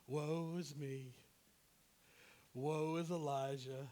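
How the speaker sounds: slow, drawn-out self-pitying moan; mock lament. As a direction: wallow slowly in hammed-up self-pity. Mock lament in Elijah's role.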